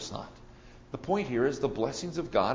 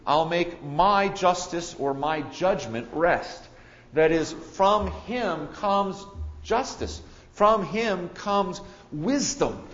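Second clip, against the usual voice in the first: slow, loud, emphatic preaching; preaching at full force; 5 semitones higher, 5 dB louder, slower than usual. Acted. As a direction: proclaim each phrase slowly.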